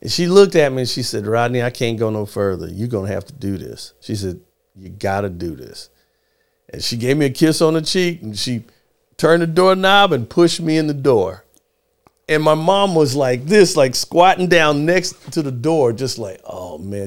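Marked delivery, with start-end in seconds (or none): 1.3-5.9 low, soft motherly send-off; hushed, earnest mom. Retold or imitated. Mom at the audition door tells her child, hushed. 16.5-17.1 low, very soft groaned aside; dismayed mom under breath. Retold or imitated. Mom squatting by the door hating it, low and hushed. Mom's retold dread outside door.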